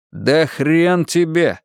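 slow, deliberate defiant words; clearly enunciated. A narrator's reading. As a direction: spell out every word of defiance. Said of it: "отчётливо выговорил Игорь". (отчётливо, с вызовом)

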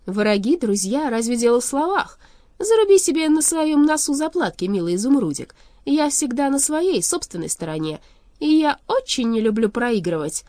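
put-on loud, high, taunting girl villain; mocking young sorceress. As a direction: needle the loser with relish.

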